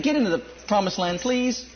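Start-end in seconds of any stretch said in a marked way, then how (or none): none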